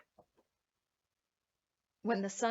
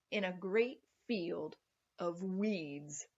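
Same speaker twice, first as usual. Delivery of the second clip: ordinary delivery, nothing marked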